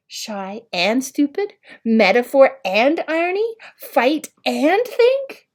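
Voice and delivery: slow, high, wondering repeats; dazed echo. A narrator's reading. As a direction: turn the words over in disbelief. Oliver's dazed echo of insults.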